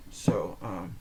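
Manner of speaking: usual speaking voice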